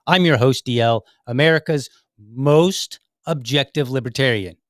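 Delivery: loud, grand announcement of his title; emphatic host intro, played for laughs; with emphasis. Shown as a demonstration. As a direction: punch it like a proud boast. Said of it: "I've gotta say that with emphasis".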